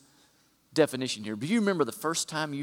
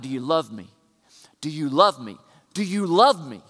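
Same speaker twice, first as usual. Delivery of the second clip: each repeat louder and more insistent than the last; growing louder each time; 6 dB louder than usual. Shown as a demonstration. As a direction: build the question up, bigger every time. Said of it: "Loving is like it gets bigger every time".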